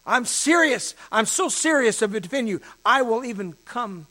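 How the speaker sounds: loud, high, insistent vow; emphatic declaration. Acted. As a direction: declare your devotion at full voice. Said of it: "He is demonstrating his declaration".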